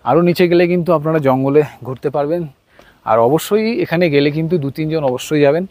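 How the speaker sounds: normal talking voice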